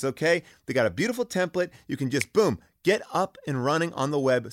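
upbeat sponsor read, punchy and salesy. Acted. Host pitching Squarespace to listeners, energetic ad read. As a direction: sell it like a radio spot.

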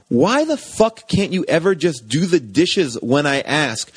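ordinary talk

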